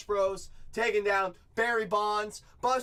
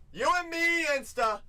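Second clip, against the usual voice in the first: high, louder, lifted call; excited call; 11 semitones higher, 5 dB louder than usual. Real, not acted. Breaker calling out to buyer Insta, excited high call. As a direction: sing out the buyer's name.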